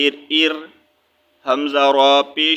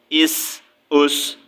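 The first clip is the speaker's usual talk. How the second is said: usual speaking voice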